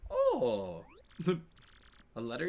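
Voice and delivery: ordinary delivery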